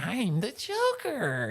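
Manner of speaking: high, slow hammed-up Joker voice; mock Joker. Retelling the cut scene, hammed-up Joker voice. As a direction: drawl it like a silly Joker. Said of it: "the Joker's like".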